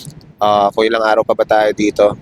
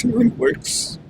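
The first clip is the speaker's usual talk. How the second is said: tearful whisper; 11 semitones higher, 7 dB quieter, much slower than usual. Real, not acted. Emotional, voice cracks.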